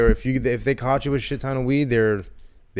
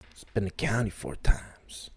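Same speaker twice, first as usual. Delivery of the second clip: hushed confessional whisper; 3 semitones lower, 6 dB quieter than usual. Acted. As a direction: lean in and whisper it. Dramatic confession aside.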